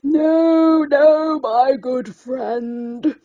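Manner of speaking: put-on old lobster gently turning down her small friend; cracked ancient voice. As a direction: creak it out like an ancient sea creature. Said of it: "cracked and ancient".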